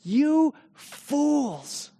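high, sharp condemning cry; loud rebuke. Acted. Rabbi insulting his host's guests, high and sharp. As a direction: cut them down with it. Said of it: "in an even louder voice, he says".

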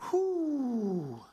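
long high whoop, falling away; high whew. Real, not acted. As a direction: exhale a high, drawn-out whew. Exclaims during praise.

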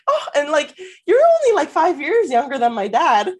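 high, loud mock flirting; hammed-up, high-pitched fawning; over-the-top gushing.

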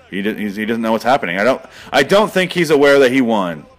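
usual speaking voice

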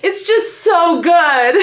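high, loud, slow, gushing delight. Real, not acted. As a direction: stretch it out with pure joy. Gushing over the book.

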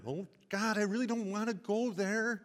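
mock reluctant plea to God; reluctant complaint. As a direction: whine that you don't want to go. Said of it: "You can make that comment".

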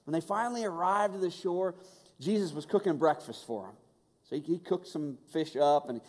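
ordinary talk

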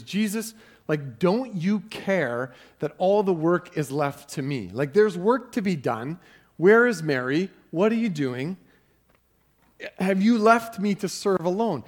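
loud, high, complaining Martha; exasperated Martha. Retold or imitated. Martha to Jesus, loud high-pitched complaint. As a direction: plead with Jesus as the one left with the work. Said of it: "she comes to Jesus and says".

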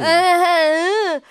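loud high fake bawling; imitated drunken wail, high and loud; mock sobbing wail. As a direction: bawl wordlessly, hammed up. Finishes imitating her drunk sobbing.

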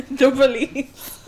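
laughing through the words; slow, cracking up. Real, not acted. Laughing at the police question.

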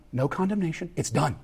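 ordinary talk

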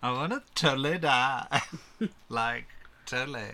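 mock valley girl whine. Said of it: "Why do you sound like a valley girl?".

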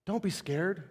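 put-on soft, hushed comfort to a scared child; quiet, tender reassurance; soothing parent. Shown as a demonstration. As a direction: murmur it gently like a parent at the bedside. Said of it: "No, we go".

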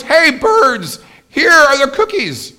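high, ringing storybook shout; loud shout. A narrator's reading. Frog shouts to the birds in the story, loud calling voice. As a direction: call out at full volume to far-off birds. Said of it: "He shouted in a loud voice".

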